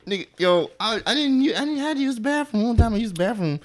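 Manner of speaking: excited, high and loud. Real, not acted. Excited retelling.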